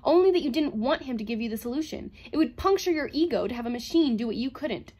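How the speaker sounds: forceful, cutting retort; crying out. A narrator's reading. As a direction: accuse him, high and loud. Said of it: "cried Calvin".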